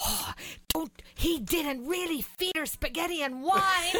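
lightly voiced wife correcting a gullible listener; wife's voice. The client's wife assures the realtor it was a joke, lightly voiced with mild amusement. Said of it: "His wife looks at me".

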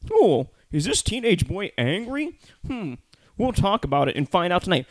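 high, singsong mock TV host; hammed-up teasing of the next segment; Chris Hardwick. Mocking a recap host posing an obvious question, high and hammed-up. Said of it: "Chris Hardwick goes".

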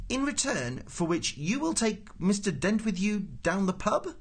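high, slow, careful question; bargaining official. Acted. Prosser confirms his bargain with Ford, high and deliberate. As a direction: spell out the deal in a high voice.

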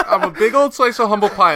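laughing through the line; giggling while saying it. Real, not acted.

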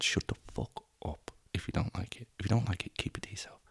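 hushed, breathy telling-off; whispered aside. Real, not acted. Podcaster to listeners who dislike it, whispered. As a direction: hiss it under your breath. Telling haters to keep quiet.

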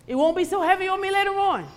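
high-pitched mock self-justifying voice; pleading rationalization. Preacher retelling her bargain with the Lord, high and wheedling. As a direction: push it up high as a wheedling excuse.

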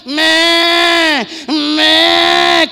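loud shouted bleat "Me, me"; bleating animals. Acted. Preacher voicing the hidden animals crying out, loud bleating. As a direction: cry out like frightened livestock. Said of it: "comenzaron a gritar los animales".

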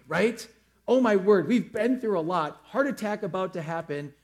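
normal talking voice

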